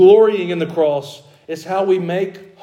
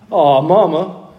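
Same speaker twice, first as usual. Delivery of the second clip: put-on country-boy Southern accent, whining; Southern country drawl; 3 dB louder, slower than usual. Southern boy whining back at his mama, country accent. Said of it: "I was a country boy from the South".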